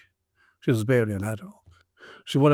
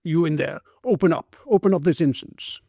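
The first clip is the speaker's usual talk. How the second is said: loud, higher demand to open up; commanding shout; 5 semitones higher, 4 dB louder than usual. A narrator's reading. Policeman repeats his shout at the locked door. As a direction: order them out, insistent.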